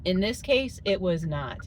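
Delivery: normal talking voice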